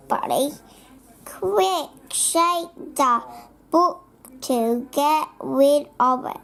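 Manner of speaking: usual speaking voice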